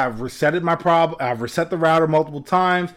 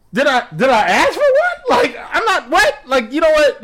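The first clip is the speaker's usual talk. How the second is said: laughing disbelief; 9 semitones higher than usual. Real, not acted. Incredulous at the upsell.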